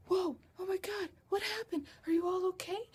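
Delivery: high-pitched imitation of a worried librarian; mock-alarmed, voice jumping way up. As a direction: play someone rushing over, startled and concerned. Retold alarmed librarian rushing over.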